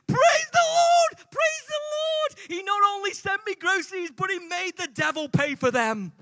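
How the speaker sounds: extremely high, exultant cries easing slightly; jubilant shouting. Acted. Elderly lady celebrating her free groceries, jubilant shriek. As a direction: shriek praise as if jumping and clapping. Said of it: "starts jumping up and down".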